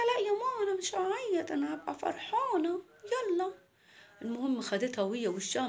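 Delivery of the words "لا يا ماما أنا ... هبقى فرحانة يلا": put-on high child voice promising mum; little girl Mona. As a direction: pitch it up like a small girl. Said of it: "قالت لها". (صوت بنت صغيرة بتوعد مامتها)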